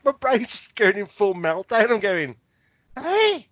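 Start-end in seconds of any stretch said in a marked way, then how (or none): 3.0-3.5 high confused hey what; stunned, floundering; baffled. Retold or imitated. Brain in full meltdown.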